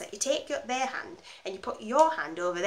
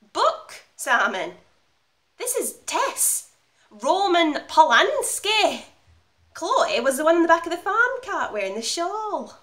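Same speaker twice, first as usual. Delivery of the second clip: high, emphatic, drawn-out indignation; affronted correction; 8 semitones higher, 6 dB louder, slower than usual. Acted. Actress correcting director who mistook her film for a book, affronted. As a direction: correct him as if he should know the famous film.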